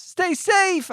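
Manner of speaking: high, loud parting shout; urgent high-pitched yell. Acted. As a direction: yell it to your child in danger. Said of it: "I yell".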